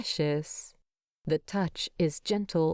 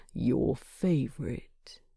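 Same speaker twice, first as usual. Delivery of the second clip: indulgent, pleased; 6 semitones lower than usual. Acted. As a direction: breathe it out fondly, proud of the gift. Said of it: "His tone is indulgent and so very pleased with himself".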